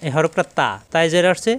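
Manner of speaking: ordinary delivery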